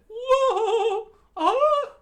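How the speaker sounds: very high, loud falsetto shriek; squeaky scream. Acted. Character dodging the Lupin's teeth, in a high shriek. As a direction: yelp and shriek in a cartoon voice.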